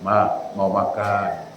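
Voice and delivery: slow, soft and tender, vowels stretched; gentle drawn-out request. Shown as a demonstration. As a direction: ask your wife sweetly and slowly. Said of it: "Tapi pakai tajwid dia".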